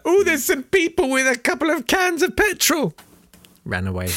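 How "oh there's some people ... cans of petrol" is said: high, unbothered put-on voice; casual dismissive voice. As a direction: shrug it off in a high silly voice. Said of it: "but he was like".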